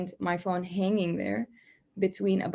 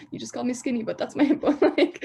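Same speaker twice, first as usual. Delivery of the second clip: incredulous, higher voice breaking into laughs; laughing; 4 semitones higher, 4 dB louder, faster than usual. Real, not acted. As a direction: protest through a laugh. Laughing while retelling the bone incident.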